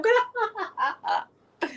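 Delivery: slow, high giddy laugh; gas-fuelled laughing. Retold or imitated. Mother recalling gas during labour, laughing giddily. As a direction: laugh helplessly between contractions. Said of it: "laughing like that".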